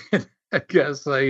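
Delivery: ordinary delivery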